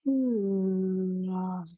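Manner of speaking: drawn-out long exhale. Shown as a demonstration. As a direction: stretch long out on the breath. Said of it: "we have".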